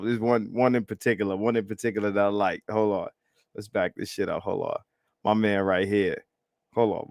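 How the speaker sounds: normal talking voice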